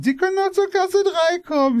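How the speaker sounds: high cashier voice. Retold or imitated. Storyteller imitating the Aldi cashier in a high voice. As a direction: play the friendly cashier in a high voice.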